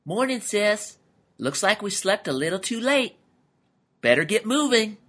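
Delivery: high, chipper cartoon camel; high-pitched morning cheer; enthusiastic. A narrator's reading. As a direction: greet your sister with upbeat energy. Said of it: "said to Mercy enthusiastically".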